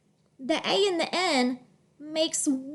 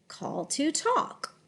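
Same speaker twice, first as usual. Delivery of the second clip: slow, connected "Call to talk"; softer, flowing "Call to talk"; smooth phrase reading; 3 semitones higher, 5 dB quieter than usual. Shown as a demonstration. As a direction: glide through "Call to talk" as one phrase. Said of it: "read smoothly in phrases".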